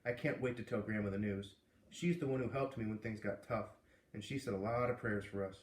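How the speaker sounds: plain reading voice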